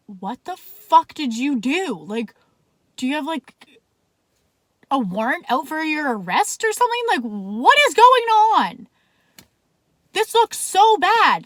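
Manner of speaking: high, loud, slowing panic; rising alarm, pleading with her friend; panicked outburst. Retold or imitated. Demanding answers from Jacob as undercover cars arrive, panicked.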